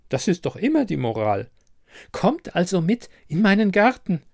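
high crone voice, lecturing and coaxing; high-pitched, persistent old beggar woman; insistent old woman. A narrator's reading. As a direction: press your point like a stubborn old granny. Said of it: "Trotzdem blieb das Mütterchen beharrlich".

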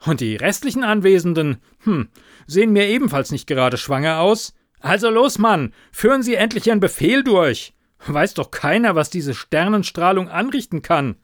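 loud, high, overriding voice; interrupting loudly. A narrator's reading. Superior interrupting the officer, loud and high. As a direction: cut him off and talk over him.

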